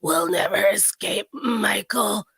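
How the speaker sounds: slow, slightly raspy grandma impression; smoky raspy voice. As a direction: drawl it slowly, a touch of rasp. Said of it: "in a smoky, raspy voice being like".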